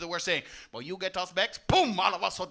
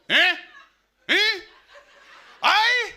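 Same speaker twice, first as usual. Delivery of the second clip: loud, high Jamaican grunts of vowels; Jamaican vowel talk; 7 semitones higher, 6 dB louder, much slower than usual. Shown as a demonstration. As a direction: bark the vowels, Jamaican style. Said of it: "communicate using just the vowels".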